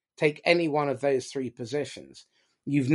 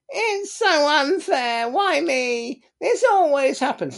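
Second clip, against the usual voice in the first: high, slow, wailing self-pity; whiny victim voice; 13 semitones higher, slower than usual. Shown as a demonstration. Speaker demonstrating the victim of the drama triangle, high whiny wail. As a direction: moan it like the eternal victim. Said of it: "The victim's voice sounds like".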